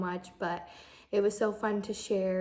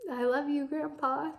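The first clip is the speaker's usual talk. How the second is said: real tearful tightness, pitch up high; holding back tears, voice straining upward; emotional; 6 semitones higher than usual. Real, not acted. Granddaughter telling grandpa she loves him, choked up. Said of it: "I'm probably just emotional because I'm pregnant".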